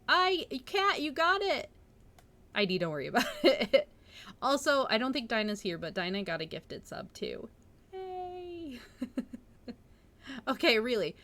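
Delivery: ordinary delivery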